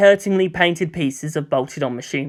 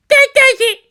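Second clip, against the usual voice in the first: put-on much higher, louder child's shout; kid's yell; 7 dB louder than usual. A narrator's reading. A kid yelling to start the dogpile on Toji. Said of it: "a yell sounded".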